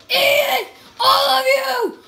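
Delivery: high shout broken by laughter; laughing battle yell. Real, not acted. Gamer mowing down enemies, laughing while yelling.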